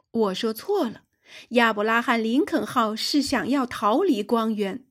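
ordinary delivery, nothing marked

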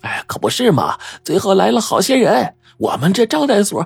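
high, gossipy and thrilled; delighted landlady. A narrator's reading. Landlady recalling the landslide and bodies, happy high gossip. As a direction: relish the gossip, pitch climbing high. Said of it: "她高兴地说".

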